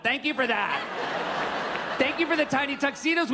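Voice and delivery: high-pitched, exasperated mock gratitude; sarcastic thanks. Guest thanks bride's father for tiny tuxedos, sarcastic rant. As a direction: rant the thanks with rising indignation.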